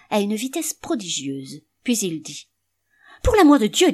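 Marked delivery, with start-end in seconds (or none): 3.2-3.9 high, loud cry to heaven; loud, high exasperated exclamation; exasperated outburst. Acted. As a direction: throw the oath up loud and high. Henchy exclaims, calling for coal.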